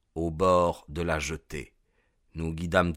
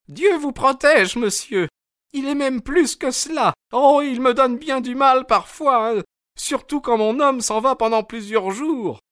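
high, chatty woman's voice; a boatman's wife rattling on to a stranger; talkative landlady; 4 dB louder than usual. A narrator's reading. Mrs Smith voiced in story. (femme bavarde, voix aiguë)

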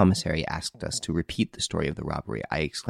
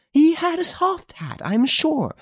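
higher, slow and emphatic lady; a woman who won't back down; insistent lady; 4 dB louder, slower than usual. Acted. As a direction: press each word home.

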